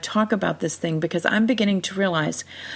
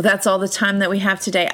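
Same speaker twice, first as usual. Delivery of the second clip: ordinary talk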